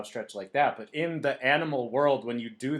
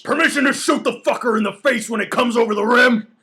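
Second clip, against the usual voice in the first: high, loud, voiced soldier shout; Harris voice; 10 semitones higher, 11 dB louder than usual. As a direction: bellow it like an eager sergeant. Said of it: "that's how Harris sounded".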